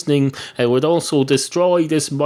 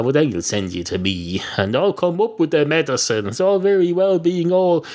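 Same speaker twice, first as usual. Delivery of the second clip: usual speaking voice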